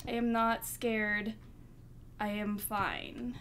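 slow, measured, word by word; deliberate composure. Acted. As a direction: insist slowly that you are unshaken. Putting on bravery after scare.

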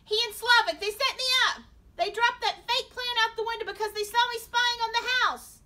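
high, loud, desperate accusation; frantic babbling. A narrator's reading. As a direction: shout the excuse out in a panic. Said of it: "Darren babbled".

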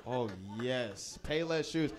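ordinary talk

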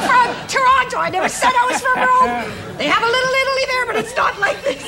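high laughing mock protest. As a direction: giggle through the excuse. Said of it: "It's like".

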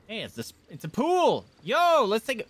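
high, loud excited burst; thrilled at finding it. Real, not acted. Discovers a pool excitedly.

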